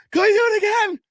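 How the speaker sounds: put-on high, loud little-kid plea; eager child. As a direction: squeal it like a kid who wants another ride. Daughter begging after the slide.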